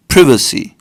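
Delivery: privacy said PRIV-uh-see; British pronunciation. Shown as a demonstration. Showing viewers the British privacy, British pron. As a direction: say privacy with a short i. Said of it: "British people call this".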